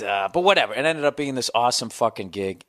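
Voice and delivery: normal talking voice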